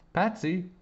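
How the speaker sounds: high-pitched call for someone; summoning someone by name. A narrator's reading. Calling out for Patsy.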